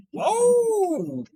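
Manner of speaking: high startled whoa; sudden yelp of surprise; surprised whoa. Real, not acted. Startled exclamation on stream.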